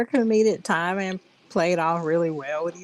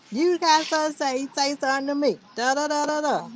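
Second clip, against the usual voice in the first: a hint of a blustering country guy, higher; 8 semitones higher than usual.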